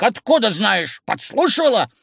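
high, accusing questions; rising indignation; indignant. A narrator's reading. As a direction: fire off outraged accusations. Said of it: "возмутился старик".

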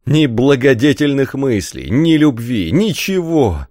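high-pitched cry of despair; anguished lament. A narrator's reading. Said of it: "из горящих глаз хлынули слёзы". (горестное отчаяние)

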